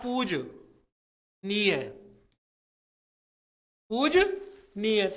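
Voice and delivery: पूजनीय said slowly with a clear ई; careful pronunciation. Shown as a demonstration. Teacher models पूजनीय slowly for students. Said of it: "जब पूजनीय नहीं होता है".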